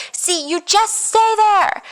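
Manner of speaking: very high, slow mock protest; acted arguing. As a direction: imitate an argument, stretched out and high-pitched. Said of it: "she keeps arguing with him".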